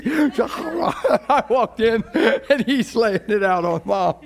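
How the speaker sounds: high-pitched laughter through the words; laughing. Real, not acted. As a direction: crack up while telling it. Laughing while retelling dad's rant.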